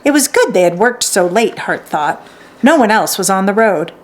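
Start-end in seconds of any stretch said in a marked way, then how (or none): none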